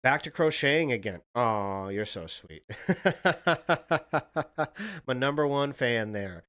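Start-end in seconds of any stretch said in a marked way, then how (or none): 2.7-5.0 long staccato giggle, pitched high; real rapid-fire cackle of amusement; real laugh. Real, not acted. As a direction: let out a run of quick, high chuckles. Laughs at viewer's sweet message.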